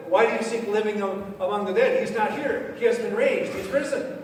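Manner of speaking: high, loud triumphant announcement; proclaiming angel. Acted. Angel tells the women, proclaiming loudly. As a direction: ring it out like an angel's news.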